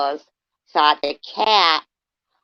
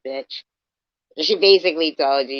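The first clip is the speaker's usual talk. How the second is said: normal talking voice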